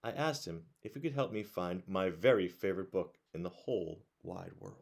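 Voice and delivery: plain reading voice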